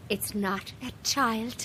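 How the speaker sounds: quiet. Acted. Medium contradicting her quietly.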